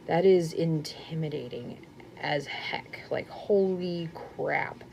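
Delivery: hushed whisper, slowing at the end; whispered mock dread. Recalling her reaction to the blanket, in a mock-daunted whisper.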